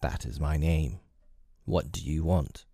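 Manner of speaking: low, cool and unhurried, a touch guarded; cool reply. A narrator's reading. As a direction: answer coolly, giving nothing away. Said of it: "he answered coolly enough".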